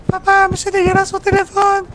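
high whiny cartoon kid; switching impression voices. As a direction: complain to dad like a little boy. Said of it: "la meilleure imitation de Caillou".

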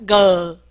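g as a long falling "guh"; 整合发音示范. Shown as a demonstration. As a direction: blend the 了 and 的 sounds into a drawn-out g. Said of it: "了的整合一下". (整合成一个音，拉长念g)

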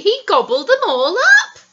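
loud, high gleeful telling with a laugh; gleeful storyteller reveals the twist; laughing climax. A narrator's reading. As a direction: relish the gobbling.

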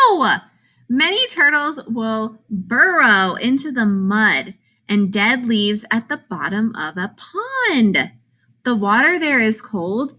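ordinary delivery, nothing marked

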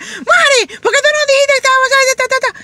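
high, loud indignant reproach; mock angry complaint. Angry coworker scolding Mari over missed gossip. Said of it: "me venían a reclamar y decían".

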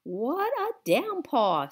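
put-on high, slow old lady. A narrator's reading. Miss Franny marvels at the rain, high and slow.

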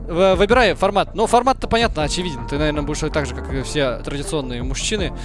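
ordinary delivery

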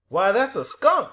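loud, high exclamation of recognition; astonished cry. A narrator's reading. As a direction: cry out in delighted surprise. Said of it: "cried Aislinn".

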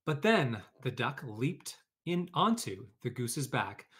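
lively read-aloud storytelling voice; story narration. A narrator's reading. Teacher reading a picture book to her class, lively storytelling.

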